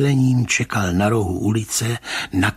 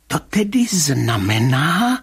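slow, higher, tense prompting; 8 semitones higher, 4 dB louder, slower than usual. A narrator's reading. Rybka asking the commissioner for a conclusion, slow tense question. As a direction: draw it out tensely, waiting for the answer. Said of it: "ptal se pan Rybka napjatě".

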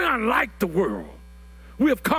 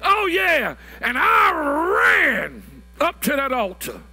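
preaching at full force; 8 semitones higher than usual. Acted. Pastor testifying to the congregation about his calling, shouting. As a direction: shout out the altar-call testimony.

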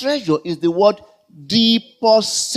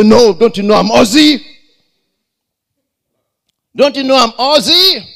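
raised, higher indignant outburst; mock indignant protest; 3 semitones higher, 5 dB louder, faster than usual. Customer insisting to bank staff that he's Aussie, indignant. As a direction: protest loudly like an affronted customer.

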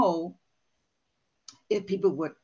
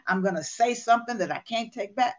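ordinary talk

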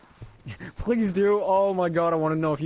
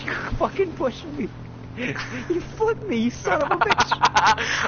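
shrieking jackpot winner; 8 semitones higher, slower than usual. Real, not acted. Teammate flips his car.

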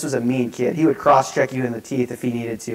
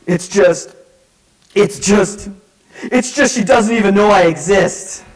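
halting, then a loud high heartbroken outburst; mock wailing sobs; 6 semitones higher, 5 dB louder, slower than usual. Crying teen confessing his heartbreak, hammed-up wail. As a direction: build to a hammed-up teenage wail. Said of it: "just sobbing".

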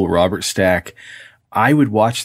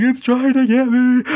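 put-on very high, panicked little-kid cry; terrified child. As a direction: squeal it like a scared kid. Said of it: "And I'm like".

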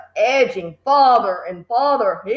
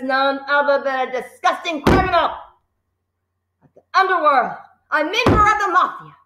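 furious tirade; 7 semitones higher than usual. A narrator's reading. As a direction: spit each insult at the boy. Headmistress berating the boy.

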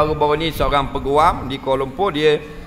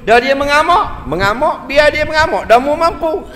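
higher, louder rallying cry; fiery urging; 11 semitones higher, 6 dB louder than usual. Real, not acted. Preacher urging husbands to marry even if the wife rages, loud. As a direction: egg the husband on loudly.

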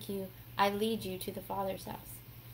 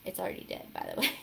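usual speaking voice

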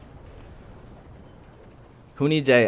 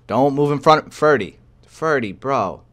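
loud, urgent warning to a teammate; yelling at teammate Ferdy in his line of fire; frantic yell; 6 semitones higher, 8 dB louder, slower than usual. Real, not acted. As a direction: shout at him to get out of the way. Teammate blocking his shot.

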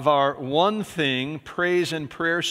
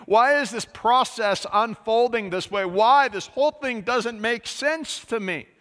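frustrated; 5 semitones higher, 4 dB louder than usual. Retold or imitated. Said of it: "You could tell he was super frustrated".

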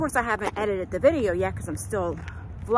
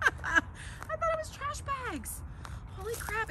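high, amazed laughing realisation; surprised; 14 semitones higher, 10 dB quieter than usual. Real, not acted. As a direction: squeal it in disbelief. Realises the bags are ice.

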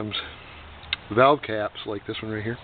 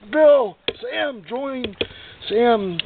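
mock scream; 10 semitones higher, 5 dB louder, much slower than usual. Shown as a demonstration. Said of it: "you can scream there".

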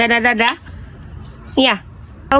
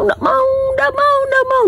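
mock whine; 12 semitones higher than usual. Mother whines at dirty hands.

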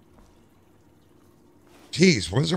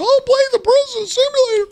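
high, whiny mock of the chat; sing-song taunt as the viewers. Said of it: "Chat room says".

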